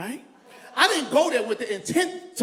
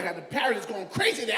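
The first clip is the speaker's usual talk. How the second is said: ordinary talk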